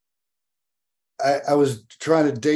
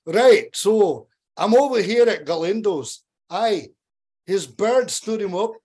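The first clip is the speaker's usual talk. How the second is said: higher-pitched Scottish buddy impression; Scottish accent; 7 semitones higher, slower than usual. Buddy phones people about his stood-up friend, mock Scottish accent. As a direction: imitate a Scottish friend spreading gossip gleefully. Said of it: "in the Scottish accent".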